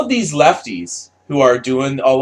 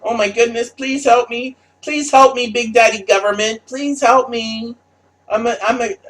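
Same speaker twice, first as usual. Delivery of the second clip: high, pleading mock victim voice, rising higher; mocking a damsel begging for rescue, pitched way up; mock helpless victim; 8 semitones higher than usual. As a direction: whine for help in a hammed-up helpless voice. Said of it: "it's like".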